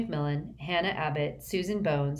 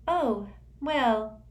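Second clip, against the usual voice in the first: airy, high and dreamy schoolgirl; dreamy girl voice; 7 semitones higher than usual. A narrator's reading. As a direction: float the words, lightly otherworldly.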